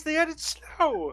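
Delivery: slow, high delighted repeat; excited. Real, not acted. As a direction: savor the news gleefully.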